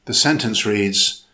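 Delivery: usual speaking voice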